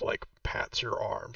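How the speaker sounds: ordinary delivery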